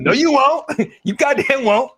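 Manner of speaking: high-pitched scoffing denial; mocking comeback. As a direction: shoot down the boast with a laugh. Mocking comeback to imagined fighter's boast.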